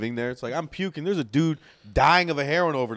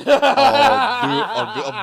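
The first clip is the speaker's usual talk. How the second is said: laughing; 11 semitones higher, 10 dB louder, much slower than usual. Real, not acted. Laughing at own pushover confession.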